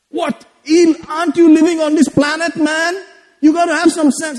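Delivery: high-pitched scolding relative; lecturing relative. Retold or imitated. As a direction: raise the pitch, nag like an elder. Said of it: "They gave me a lecture".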